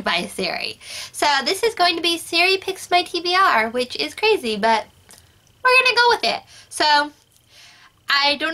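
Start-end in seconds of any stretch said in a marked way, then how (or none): 5.6-6.4 high, bright and playful; excited. Real, not acted. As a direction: sing it out, much higher. Catchphrase said brightly again.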